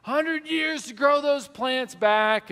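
high, slow mock holler; mimicked screaming. Stranger screaming at hikers about the plants, imitated. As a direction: stretch it out like an outraged far-off shouter. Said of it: "just screaming them".

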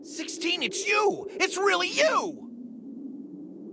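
rising, overjoyed shout of recognition; delighted shout at a long-lost friend; excited recognition. Acted. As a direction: cry out his name, thrilled to see him again. Reunion with lost old friend.

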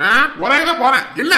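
loud, high, impatient retort; irritated shout. Acted. Husband annoyed at being asked where he goes, irritated shout. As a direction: fire it back angrily. Husband snaps at wife's question.

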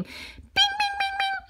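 very high, quick "bing" noises; rapid string of high bell pings; idea chime. Acted. Imitates idea chime again.

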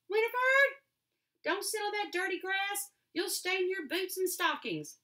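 put-on high, loud, thin old woman's pipe; a fretting grandmother calling from the window, high and piping. A narrator's reading. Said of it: "a thin voice, her grandmother, piped".

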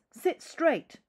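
high, loud strict teacher order; teacher barking at the class, loud and high; stern teacher. Acted. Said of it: "telling the class".

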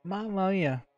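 ordinary delivery

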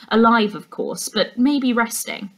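ordinary delivery

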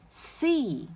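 C begun on a hissed, voiceless s. Shown as a demonstration. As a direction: say C with a clean voiceless s at the start. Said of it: "它是一个清辅音".

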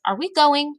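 put-on high-pitched girl's question; high-voiced storybook Sophie; questioning little girl. A narrator's reading. Girl asks about leaving.

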